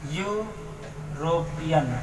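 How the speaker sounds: European drawn out very slowly and softly; teacher models the word slowly for the class. Shown as a demonstration. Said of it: "उसी प्रकार से है European.".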